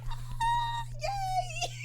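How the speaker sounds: tiny, very high-pitched squeal; fangirl squeal. Real, not acted. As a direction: squeal it softly, right at the top. Squealing over animated favourite.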